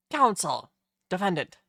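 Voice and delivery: loud court announcer; bailiff announcement. Acted. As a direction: call the court to session. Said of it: "The bailiff was talking".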